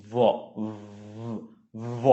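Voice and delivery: ভ as a long buzzing v, teeth on lip; buzzing v sound. Shown as a demonstration. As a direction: hold the v and let it buzz. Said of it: "ভাইব্রেশনের সাথে যে আওয়াজটা". (ভ, দাঁত-ঠোঁটের কম্পনে টানা আওয়াজ)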